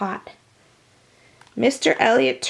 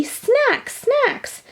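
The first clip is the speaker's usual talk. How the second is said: insisting; 13 semitones higher than usual. A narrator's reading. B B insists on snacks.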